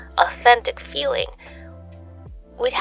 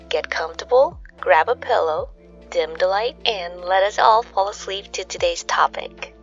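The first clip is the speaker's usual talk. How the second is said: ordinary delivery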